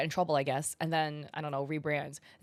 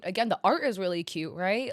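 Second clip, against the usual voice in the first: ordinary delivery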